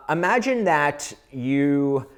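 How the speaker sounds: normal talking voice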